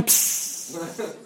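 sharp "ps" with a long hiss; balloon-popping hiss. Shown as a demonstration. Trident pops a balloon for the class, sharp hissing ps. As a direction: imitate air bursting from a balloon. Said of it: "that's the noise".

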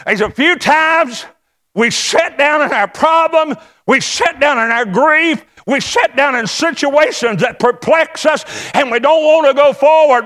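impassioned, high-pitched sermon cry; full-force preaching. Real, not acted. Preacher urging congregation to ride forward, fervent preaching. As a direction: drive each phrase home up high.

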